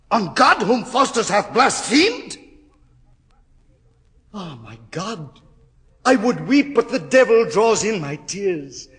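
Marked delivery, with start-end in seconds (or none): 0.1-2.4 much higher, louder horror; anguished outcry. Acted. Terror builds. 6.0-8.9 much higher, louder panicked cries; terrified screaming. Acted. Faustus in terror, held by devils.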